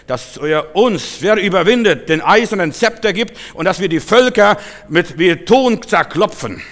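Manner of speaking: loud, high sermon voice; forceful preaching. Real, not acted. Preacher promises believers power over nations, forceful preaching.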